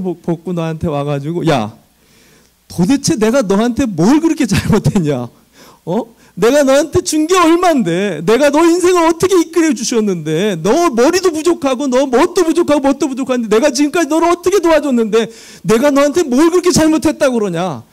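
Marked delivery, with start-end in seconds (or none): 1.5-5.3 indignant God, 그렇게 stressed and falling; God demanding what He did wrong; imagined angry God. Pretend. Pastor voicing God confronting him, indignant. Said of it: "하면서". 5.9-17.8 high, loud indignant God; God shouting back in rebuke; imagined God's outburst. Pretend. God rebuking the ungrateful pastor, loud and high, indignant. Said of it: "이렇게 막 따지지 않을까".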